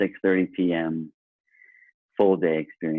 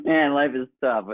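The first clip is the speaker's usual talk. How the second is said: mock lament; 8 semitones higher than usual. Joking about receiving praise.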